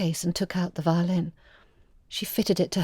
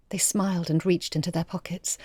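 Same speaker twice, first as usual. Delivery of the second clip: ordinary delivery, nothing marked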